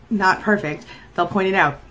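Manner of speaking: ordinary talk, nothing marked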